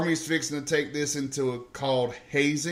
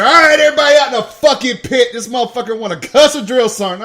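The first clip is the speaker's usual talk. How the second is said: higher, much louder, fast barked order; drill sergeant yell; 8 semitones higher, 12 dB louder, faster than usual. Retold or imitated. Sergeant ordering the platoon into the pit, loud fast yell. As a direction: bark the whole platoon out.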